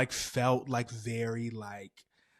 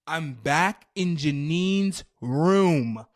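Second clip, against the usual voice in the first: very loud, hugely stretched words; dramatic loud drawl; 2 semitones higher, 11 dB louder, much slower than usual. Retold or imitated. Storyteller back in the room yet again, dramatically loud. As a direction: boom it out slowly, dragging each word. Retelling the repeated return, bigger take.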